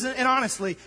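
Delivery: ordinary talk, nothing marked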